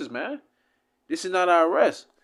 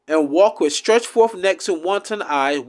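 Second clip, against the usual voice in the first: scripture reading; 5 dB louder than usual. Acted. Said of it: "Listen to this curse".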